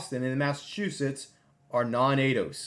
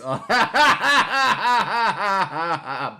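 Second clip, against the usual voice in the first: big, high burst of laughter; loud laugh; 7 semitones higher, 10 dB louder than usual. Real, not acted. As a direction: crack up loudly. Laughing at joke.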